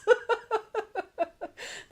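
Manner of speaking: high-pitched burst of laughter; laughing. Real, not acted. Streamer pitying a first-year middle schooler, laughing. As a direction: break into a real, high laugh.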